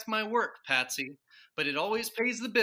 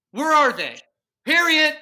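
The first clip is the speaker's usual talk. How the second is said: loud, urgent demand rising in pitch; frantic shouting; 6 semitones higher, 11 dB louder than usual. Acted. Father hunts his magazines, calls daughter, frantic shout. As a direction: yell it while searching the house.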